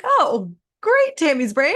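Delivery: playfully cheering thoughts on; cheery brain talk. Pretend. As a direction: gush at your own brain like a cheerleader. Cheering her own brain on.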